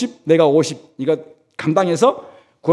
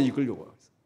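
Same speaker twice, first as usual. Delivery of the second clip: much softer repeat of 이걸 요구하고 있어요; hushed echo; 6 semitones lower, 11 dB quieter, faster than usual. Real, not acted. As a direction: drop to a near whisper on the echo. (이걸 요구하고 있어요를 작게 되뇌기)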